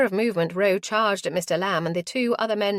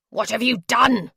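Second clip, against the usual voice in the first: louder angry roar; 4 semitones higher, 7 dB louder than usual. Acted. Lamb at his man who shot Caroline, roaring. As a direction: roar it at him. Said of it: "he roared at him".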